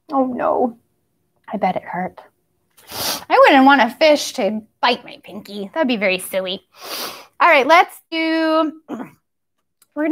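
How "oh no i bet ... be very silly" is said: ordinary delivery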